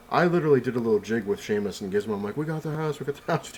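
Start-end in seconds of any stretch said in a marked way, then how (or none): 2.3-3.5 higher, giddy repeated cry; pitched-up happy celebrating; excited. Retold or imitated. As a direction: chant it like you just won. Celebrating with the dogs.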